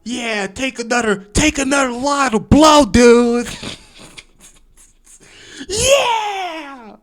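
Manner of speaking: hyped party bro. Pretend. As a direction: whoop like a coked-up frat boy. Mocking partying frat guy.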